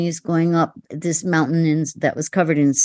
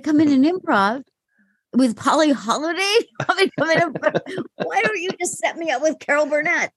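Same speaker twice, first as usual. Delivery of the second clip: high, fast, laughing disbelief; panicked disbelief; 8 semitones higher, faster than usual. Retold or imitated. Actress to director about improvising, high panicked disbelief. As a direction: rattle off the protest in a panic.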